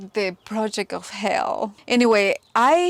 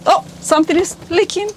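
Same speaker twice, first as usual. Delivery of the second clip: surprised; 7 semitones higher, 5 dB louder, faster than usual. Real, not acted. Sudden leak.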